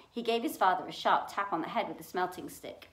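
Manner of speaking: ordinary delivery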